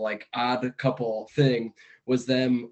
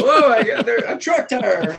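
high laughing yell, louder; excited high yell; 10 semitones higher, 5 dB louder than usual. Retold or imitated. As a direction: shout it out gleefully. Farley car scene acted.

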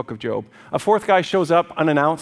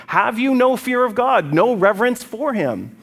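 high, loud, slow indignation; indignant rebuke; 8 semitones higher, 4 dB louder than usual. Acted. Eliphaz accusing Job of irreverence, loud indignant rebuke. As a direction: reproach him with raised pitch and drawn-out words.

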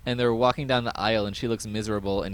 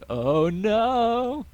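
dismayed oh no; 10 semitones higher, 5 dB louder than usual. Retold or imitated. Retold dismay of heroine.

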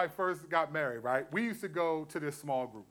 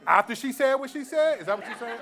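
laughing disbelief; 6 semitones higher, 8 dB louder than usual. Retold or imitated. Retold reply in small group.

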